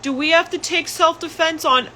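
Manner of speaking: put-on high childlike nephew voice; nephew's voice. Nephew asking about Auntie Ange, high childlike. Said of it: "my nephew was crying because he's like".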